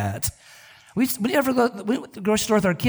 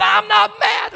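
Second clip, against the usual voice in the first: much higher, louder angry insistence; shouted denial; 13 semitones higher, 6 dB louder, slower than usual. Retold or imitated. Husband to wife, shouting he isn't mad. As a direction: bark it, still furious.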